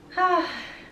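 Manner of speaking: weary sigh. Real, not acted. Fed up with symptoms the doctor can't fix, weary sigh.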